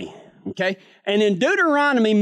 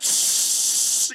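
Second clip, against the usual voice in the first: spray hiss; 3 dB quieter than usual. Retold or imitated. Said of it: "I went".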